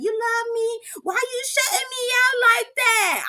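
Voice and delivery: high whiny mock wail of a sister; mock yelling. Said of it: "Sherry yelling".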